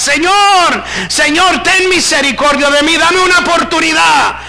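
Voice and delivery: high, pleading cry; crying out for mercy. Acted. Lost souls begging the Lord from hell, crying out. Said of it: "en un lugar oscuro gritaban".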